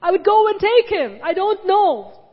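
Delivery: anguished wail of a grieving woman; desperate cry. Retold or imitated. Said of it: "She's saying".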